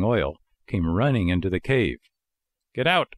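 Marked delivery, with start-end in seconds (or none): 2.7-3.1 a higher, mildly threatening order. A narrator's reading. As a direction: warn the dog off, higher but not shouted. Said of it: "cried the fisherman threateningly".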